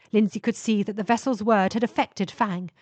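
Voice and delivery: ordinary delivery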